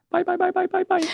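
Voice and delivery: imitating a tiny, high child's voice; high-pitched little kid impression; cute little voice. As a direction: squeak it like a little kid calling out. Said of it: "I hear this cute little, cute little voice go".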